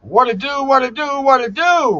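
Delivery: high, loud show-opening holler; excited streamer kicking off the show, loud and high; hyped greeting. Real, not acted. Host's hyped opening call to stream.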